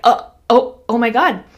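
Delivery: loud, startled retold reaction. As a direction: act out being taken aback. Startled on seeing the critter.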